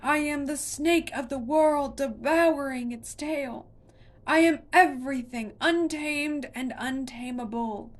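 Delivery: still roaring. A narrator's reading. Monster roars its ancient nature at Connor. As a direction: chant it like a mountain speaking. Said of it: "still roaring".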